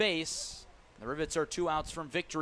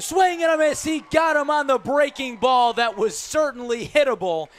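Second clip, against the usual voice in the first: excited shout; 7 semitones higher, 9 dB louder than usual. Real, not acted. Strikeout on a breaking ball.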